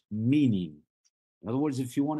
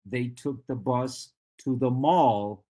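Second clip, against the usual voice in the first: "mall" punched as the contrasting word; stress on MALL; 3 dB louder than usual. Shown as a demonstration. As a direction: set "mall" against the right word by stressing it. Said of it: "It's not".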